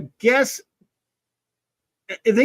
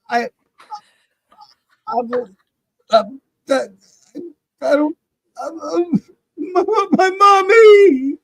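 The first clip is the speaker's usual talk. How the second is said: mock sobbing, much higher, louder, slow; fake crying; 10 semitones higher, 7 dB louder, slower than usual. Host pretends to cry for his mommy as a joke. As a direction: ham up a childish wail for mommy. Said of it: "So bad".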